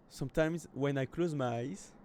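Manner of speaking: slow English reading with a French accent. Real, not acted. Streamer reading the game's intro aloud, French accent. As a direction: read English slowly through a French accent. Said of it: "désolé mon accent anglais".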